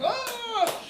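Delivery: ordinary talk, nothing marked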